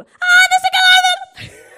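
loud, high mock yell with a laugh; family yelling. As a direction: imitate the relatives screaming over each other. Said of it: "ela de gritar assim". (grito exagerado e agudo, imitando a família)

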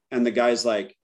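normal talking voice

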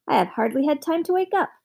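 quick, high-pitched irritable protest; snappish. A narrator's reading. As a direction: snap it out in a hurry. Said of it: "snapped the farmer".

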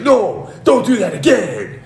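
high, drawn-out wail; mock pained pleading. Headbutted wrestler begging his attacker, mock pained wail. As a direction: plead slowly as the hammed-up victim.

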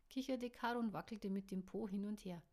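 plain reading voice

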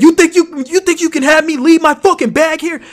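high, loud imitation of an angry man; outraged rant. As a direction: yell it in indignant disbelief. Imitating brother ranting at TSA.

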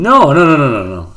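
loud burst of rapid denials; emphatic protest. Real, not acted. Denying the co-host's tiramisu guess, emphatic protest. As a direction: start high and loud, then drop.

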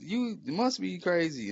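high, slow, laughing; amused high mock disbelief; laughing through it. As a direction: crack up while saying it. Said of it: "And I'm like".

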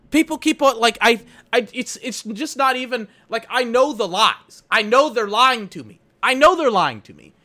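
high, loud exasperated rant; pissed off. Real, not acted. Complaining about managers' lies, high and loud. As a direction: vent hard at the injustice. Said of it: "I was mega pissed off".

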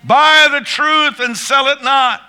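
loud, high, slow preaching call on "Love the truth"; preaching climax. Real, not acted. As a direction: thunder it out to the congregation.

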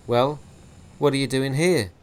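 loud, hard demand at gunpoint; menacing mortician. Acted. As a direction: press him for an answer. Gibson threatens with pistol.

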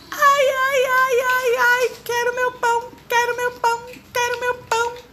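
very high, loud whining monkey cry; hammed-up squeaky monkey wail. A narrator's reading. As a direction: sob like a small monkey who lost everything. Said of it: "bradou o macaco, gemendo e chorando".

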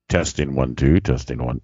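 deep mic-test voice; low voice. Acted. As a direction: drop the voice low for a mic check. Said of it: "Well, that was even lower".

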